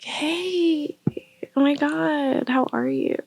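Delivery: high, soft, drawn-out hello; mock-sweet greeting. Vlogger voicing Jenna greeting the tied-up man, mock-sweet. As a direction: imitate an over-sweet, casual greeting.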